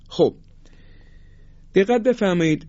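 ordinary delivery

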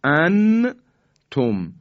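انتم with a clear, fully sounded n; انتم in two parts, no long hum; wrong izhar. Shown as a demonstration. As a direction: say an-tum loudly, n released before the t. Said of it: "این اظهاره".

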